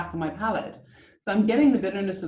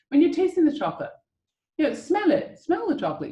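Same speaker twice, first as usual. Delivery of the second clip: tasting guide; 8 semitones higher, 3 dB louder than usual. Acted. Guiding a live tasting.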